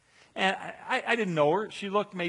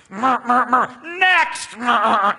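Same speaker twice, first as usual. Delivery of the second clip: loud mock clipper buzz then a high shouted next; buzzing barber; 8 semitones higher, 13 dB louder, much slower than usual. Preacher imitating the father-in-law shaving heads, loud mock barber shout. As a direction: ham up a gruff army barber calling the next man.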